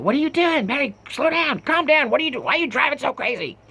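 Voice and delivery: high-pitched imitation of panicked passengers; mock a carful of women complaining, very high; squeaky mock complaint, rushing at the end. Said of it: "the women start complaining".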